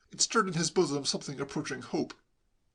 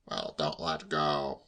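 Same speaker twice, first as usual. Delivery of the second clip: low, slow warning; grumpy uncle; 11 semitones lower, much slower than usual. A narrator's reading. Uncle on ladder to flippant nephew, grumpy. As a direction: order it slowly and low.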